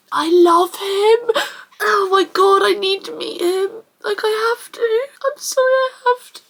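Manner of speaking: high, tearful gushing; crying. Real, not acted. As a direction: wail it helplessly through real tears. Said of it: "I'm crying as if something's happened".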